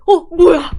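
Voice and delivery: loud, high startled reaction; re-enacted jolt of surprise. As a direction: blurt it out, caught off guard. Acts out catching the thrown towel.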